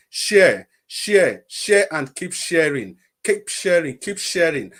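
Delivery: loud, insistent repeated appeal; loud urging. Real, not acted. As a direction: call it out, pressing hard. Urging viewers to share.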